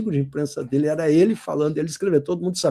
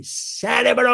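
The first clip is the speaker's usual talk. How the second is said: CÉREBRO stretched out high; slow, high-pitched, emphatic "cérebro"; drawn-out exclamation; 6 semitones higher, much slower than usual. Shown as a demonstration. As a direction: call out "cérebro", each syllable held long. Teacher hammering a key word.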